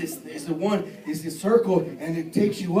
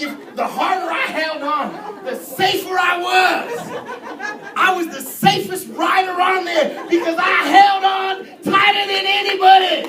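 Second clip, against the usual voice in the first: higher and louder, laughing into a shout; laughing build to a shout; 9 semitones higher, 10 dB louder, slower than usual. Real, not acted. Preacher mocking his tight grip on the ride, laughing shout. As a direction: ramp up laughing at yourself.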